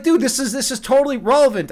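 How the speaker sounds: indignant protest. Retold or imitated. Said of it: "I was like".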